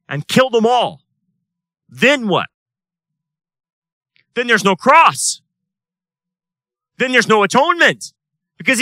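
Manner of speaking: loud, high, impassioned sermon voice; fiery preaching. Acted. Pastor rallying his congregation, loud and fiery. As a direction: preach it at full force to the congregation.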